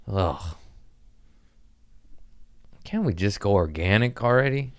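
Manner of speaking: ordinary delivery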